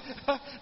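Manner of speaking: short, high chuckle; amused chuckle. Real, not acted. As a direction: laugh at your own mishap. Laughs telling how hosts fled. (cười khúc khích)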